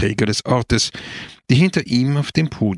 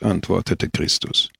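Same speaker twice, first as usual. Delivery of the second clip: plain reading voice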